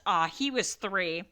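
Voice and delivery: ordinary talk, nothing marked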